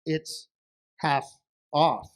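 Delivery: "It's... half... off", a pause between words; deliberate, broken-up "It's half off"; word-by-word repeat. Acted. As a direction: repeat "half off" slowly, spacing each word. Wife repeats answer word by word.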